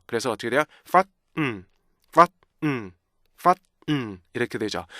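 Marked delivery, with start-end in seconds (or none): 0.9-4.2 FUN split into a stopped fa, then hummed N; "fun" in two detached beats. Shown as a demonstration. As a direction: punch "fun" open, stop, then hum the N. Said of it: "이것도 두 개의 리듬이 있어요".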